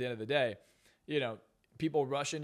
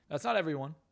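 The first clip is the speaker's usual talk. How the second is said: ordinary talk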